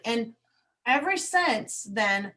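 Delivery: ordinary talk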